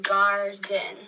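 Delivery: "garden" broken into gar and den; "garden" in two separate beats, gar, den; split into syllables. Shown as a demonstration. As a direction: say "garden" one syllable at a time. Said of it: "divide those into syllables".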